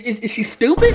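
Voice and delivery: loud, rising disbelief; incredulous mockery. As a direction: stammer into a high, baffled question. Incredulous rant, breaking into laughter.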